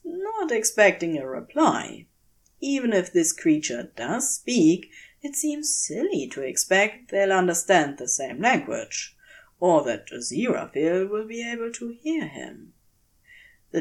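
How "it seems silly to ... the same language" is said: ordinary delivery, nothing marked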